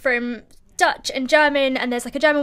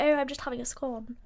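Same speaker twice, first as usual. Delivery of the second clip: a light mock posh English accent; 7 dB quieter than usual. Shown as a demonstration. Presenter shows how any line sounds in a mock posh English accent. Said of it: "you could say anything in a posh accent".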